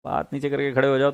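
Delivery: ordinary delivery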